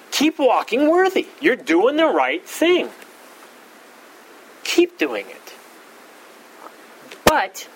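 a high, lifted voice of Paul cheering them on; Paul encouraging. Acted. Pastor voicing Paul commending the faithful, encouraging. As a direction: play Paul, gently coaxing his church to keep going.

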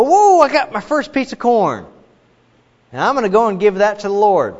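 high, loud whoop of delight; excited farmer. Acted. Farmer rejoicing over his first corn, excited. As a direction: exclaim it high and loud, thrilled.

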